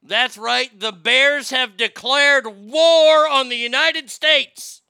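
loud, slow proclamation; hammed-up doomsday announcer; mock dramatic announcement. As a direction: declare it like breaking news of war. Dramatic announcement of bear war.

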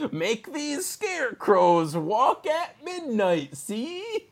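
high, slow, drawn-out put-on voice; hammed-up character voice. Host acts magic book owner's scheme, hammed-up high voice.

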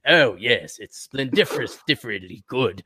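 low mock-British approval. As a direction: approve like a fake English clubman. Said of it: "none of us have British accents".

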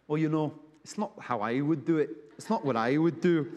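a light imitation of a friend's accent, not exaggerated; affectionate mock of a colleague's accent; Lloyd's accent. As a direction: quote him lightly in his own accent. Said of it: "Lloyd, in his great accent".

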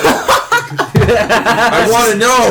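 high, slow, laughing shout. Real, not acted. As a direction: whoop it out through laughter. Friends waiting for a story.

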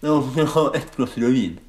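slow, garbled through popcorn; mock mouth full of popcorn. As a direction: chew through the words. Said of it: "munnen så fette full av popcorn". (hermet med munnen full)

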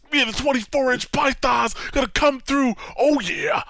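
loud macho hype voice; monster truck ad. Retold or imitated. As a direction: flex and brag like a wrestler. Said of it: "trying to get people pumped for it".

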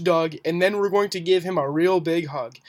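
ordinary talk

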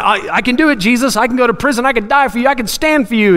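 put-on high, eager childlike boasting; all excited. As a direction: play an over-excited disciple piling up promises. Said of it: "get all excited about something".

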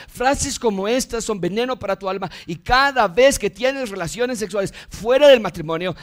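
urgent, mounting pulpit list; driving sermon delivery; impassioned preaching. Real, not acted. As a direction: pile up each charge with rising insistence.

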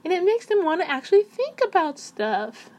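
put-on high, cutesy girly voice; baby voice. Shown as a demonstration. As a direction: keep it high and sweet. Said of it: "when you talk like that".

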